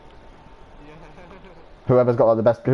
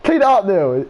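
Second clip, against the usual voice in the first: high, insistent demand; mock yelled order; 8 semitones higher, 4 dB louder, slower than usual. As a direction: order Neil to clean up. Retold order to Neil.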